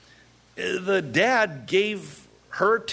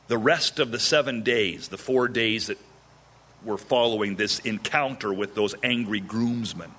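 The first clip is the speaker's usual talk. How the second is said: usual speaking voice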